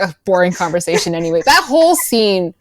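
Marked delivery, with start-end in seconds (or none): 0.0-1.4 quick, low throwaway line; muttered aside. Retold or imitated. Han muttering after bluffing the trooper, quick and low. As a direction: brush it off fast under the breath. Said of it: "And he's like".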